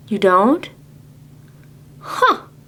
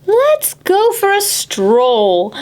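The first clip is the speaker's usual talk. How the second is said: high, slow sly suggestion; lightly voiced plotting father; scheming father; 10 semitones higher, much slower than usual. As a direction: coax her like a sneaky dad. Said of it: "her father plotted".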